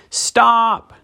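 sharp, high witch's stop; witch commanding. A narrator's reading. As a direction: halt them like a witch queen. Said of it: "said the witch".

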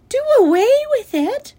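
high, loud shriek of disbelief; child's shrill outraged shriek. A narrator's reading. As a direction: shriek it like an appalled little girl. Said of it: "shrieked Fern".

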